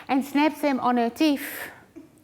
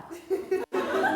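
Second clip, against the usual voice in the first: breathy laugh; 5 semitones higher, 7 dB quieter than usual. Real, not acted. Laugh after quote.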